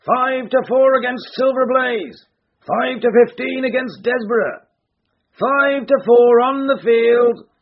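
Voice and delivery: high, slow bookie shouting odds; roaring bookies. A narrator's reading. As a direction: call out the odds slowly. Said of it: "roared the ring".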